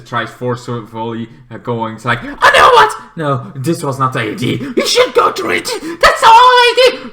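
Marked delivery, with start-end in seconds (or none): 2.2-4.7 mock shock. Figure shocked. 4.7-7.1 high, loud, laughing shout; hammed-up high scream; mock panic.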